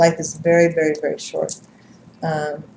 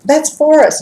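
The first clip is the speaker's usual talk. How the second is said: excited; 11 semitones higher, 6 dB louder than usual. Retold or imitated. Glenda spots the butterfly.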